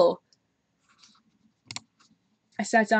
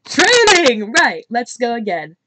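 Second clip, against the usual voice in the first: shouted, pitched up high; loud retake; 4 semitones higher, 10 dB louder, slower than usual. Acted. Redoing Sarah's line as a loud, high shout. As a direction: belt it out as a louder retake. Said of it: "what kind of accent that was".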